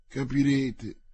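ordinary delivery, nothing marked

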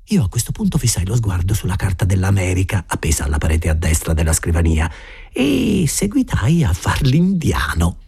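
ordinary delivery

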